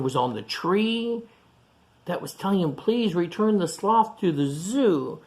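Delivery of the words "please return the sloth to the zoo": plain reading voice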